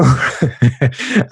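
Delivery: can't keep a straight face, laughter bursting out; breaking into laughter. Real, not acted. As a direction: start the pitch, then crack up. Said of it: "can't even say it with a straight face".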